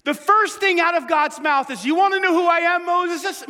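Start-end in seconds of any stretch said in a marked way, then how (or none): 1.8-3.5 eager God. Acted. God eagerly answering Moses.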